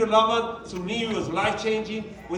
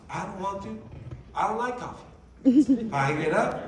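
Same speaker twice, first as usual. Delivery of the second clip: normal talking voice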